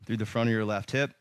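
breathless from holding the pose; out of breath. Real, not acted. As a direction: catch your breath between words. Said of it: "you just heard me kind of catch my breath".

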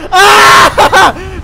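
high loud yelp of fright; startled cry; scream. Real, not acted. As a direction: shriek at the scare. Jump scare in horror game.

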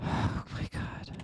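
exhausted whispered sigh. Real, not acted. Drained player after long stage.

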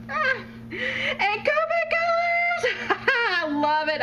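high, slow squeal into laughter; overjoyed squeal. Real, not acted. Finding Copic markers in the gift, squealing and laughing. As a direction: squeal with delight, then laugh.

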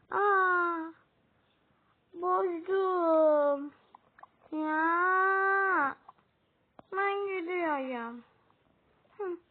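ağlamaklı, yavaş bir küsme. Acted. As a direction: bozdum diye sızlanarak ağır ağır söyle. Karakter bozulunca üzülüp gidiyor. (ağlamaklı, yavaş "ben gidiyorum")

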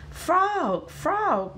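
high, eager call to a friend; high-pitched summons to come and admire; excited calling. A narrator's reading. Boasting beaver calls frog to look.

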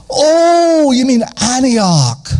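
put-on high, surprised older woman's voice, slowed down. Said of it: "And then she said".

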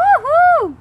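loud high whoop; cheering. Real, not acted. Mom cheering dad's can trick, loud whoop. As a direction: celebrate the open can.

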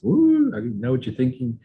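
mock psychic. Mocks a psychic.

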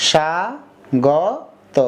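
"স্বাগত" very slowly as sha, go, to; syllable by syllable. Shown as a demonstration. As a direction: break "স্বাগত" into three drawn-out syllables. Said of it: "তো উচ্চারণটা করো তো". (ভেঙে ভেঙে ধীরে "স্বাগত")

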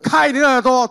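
开你的耳朵 called out loud and high. Shown as a demonstration. Preacher has the congregation shout to their neighbour, loud call. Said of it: "大大声讲".

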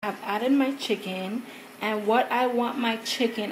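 ordinary talk

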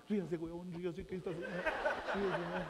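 put-on soft, hushed coaxing to a baby; soft angel voice. Angel softly urges the baby to laugh for mom. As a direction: coax it gently like a secret.

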